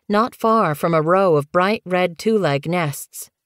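usual speaking voice

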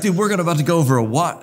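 high, hurried mock alarm; excited water particle. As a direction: play a water drop racing toward the falls.

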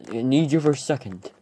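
usual speaking voice

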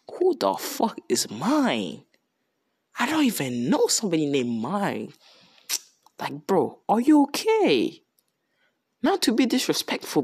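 incredulous, voice leaping high at the end; baffled disbelief. Retold or imitated. Accused hero answering the king, baffled disbelief. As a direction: imitate a man utterly thrown by the accusation.

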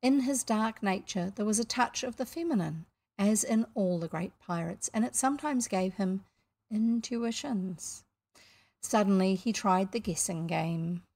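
usual speaking voice

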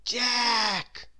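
calling Jack from under a hat. A narrator's reading. Name called, turns out teacher.

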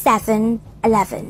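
"seven eleven" as SEV-en e-LEV-en; teacher repeats "seven eleven" with English stress; English pronunciation. Shown as a demonstration. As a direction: say "seven eleven" separately, endings falling. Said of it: "ถ้าออกเสียงถูกต้องนะคะ".

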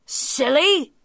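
loud, high exasperated outburst; interrupting tiger. A narrator's reading. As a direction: cut in loudly as the tiger. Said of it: "interrupted the tiger".

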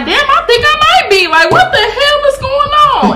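amazed realization. Retold or imitated. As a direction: squeal it out, caught off guard and giggling. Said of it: "And I was like".

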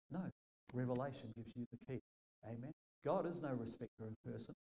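ordinary delivery

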